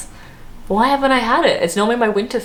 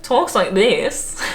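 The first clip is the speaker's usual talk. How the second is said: put-on broad Australian accent, slow and drawn out; Australian drag queen; 3 semitones higher, much slower than usual. Vlogger imitating a drag queen's speech, broad Australian accent. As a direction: imitate her broad Aussie twang, stretched out. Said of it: "that's what she's like".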